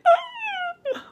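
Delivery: very high excited squeal; fangirl squeal. Retold or imitated. Fan meeting a girl YouTuber, high delighted squeal. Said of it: "'cause I'm just like".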